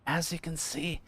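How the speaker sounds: whispering character's voice. Acted.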